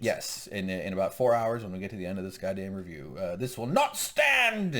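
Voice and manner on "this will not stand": high, slow mock-outraged declaration; pissed off. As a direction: thunder it out slowly in hammed-up indignation. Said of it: "And so he's pissed".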